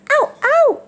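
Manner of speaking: high sharp pained yelps; real yelp of pain. Real, not acted. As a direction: cry out at the bite. Parrot bites her.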